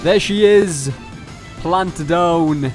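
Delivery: ordinary talk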